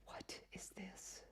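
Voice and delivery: slow, barely audible whisper; aghast whisper. Real, not acted. Web designer staring at the changed screen, aghast whisper. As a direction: whisper it slowly in disbelief.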